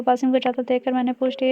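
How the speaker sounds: ordinary delivery, nothing marked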